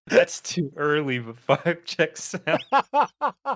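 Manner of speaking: high, slow, laughing through words. Real, not acted. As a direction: giggle the words out. Laughing at a joke comment.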